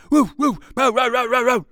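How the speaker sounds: high, very loud woofs and row-rows; dog barking. A narrator's reading. Second dog barking on the chase, loud woofs. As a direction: bark like an excited hound. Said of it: "barked the second dog".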